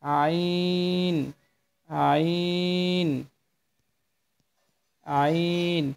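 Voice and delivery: each আইন drawn out long from the throat; teacher modelling আইন slowly; slow letter demo. Shown as a demonstration. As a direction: stretch আইন slowly for learners. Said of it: "তারপরে হরফটি".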